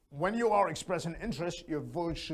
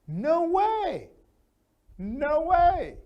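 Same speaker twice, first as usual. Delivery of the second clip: much higher and louder "No way" squeal; astonished; 12 semitones higher, 7 dB louder, much slower than usual. Shown as a demonstration. Teacher showing a strong reply, astonished. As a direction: shriek "No way" in disbelief. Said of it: "higher and louder".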